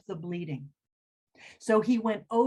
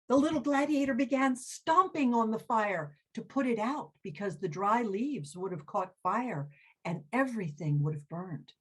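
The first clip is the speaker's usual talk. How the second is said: ordinary delivery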